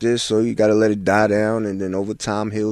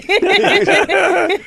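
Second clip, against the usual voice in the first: loud, high burst of laughter; real laughter; 7 dB louder than usual. Real, not acted. Telling friends the phone-call story, loud high laughter. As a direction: crack up loudly at the memory.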